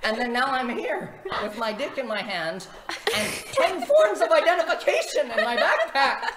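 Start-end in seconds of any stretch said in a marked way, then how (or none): 3.2-6.2 slow, loud laughing yell, much higher; crying out in laughing disbelief; laughing shout. Real, not acted. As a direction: bellow the punchline through laughter. Exasperated climax of story.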